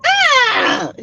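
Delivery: squeaky mock surprise; high surprised gasp. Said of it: "she's like".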